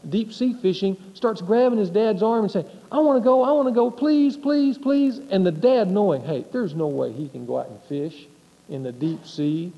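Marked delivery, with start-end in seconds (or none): 2.9-5.2 put-on higher, eager kid begging; pleading little kid. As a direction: beg like a child tugging dad's arm. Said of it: "like a little kid".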